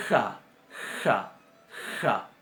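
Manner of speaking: "ja" con fricción suave atrás; sonido ja. Shown as a demonstration. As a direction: pronuncia "ja" fricativo, demostrando. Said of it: "sino que va a sonar". ("ja" pronunciado con fricción)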